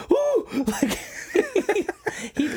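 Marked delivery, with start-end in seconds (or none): 0.1-0.5 a sudden high meerkat squeal, louder; high startled yelp. Retold or imitated. Andrew spooked on the beach, high startled yelp. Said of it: "and he just goes".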